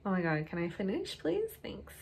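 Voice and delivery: usual speaking voice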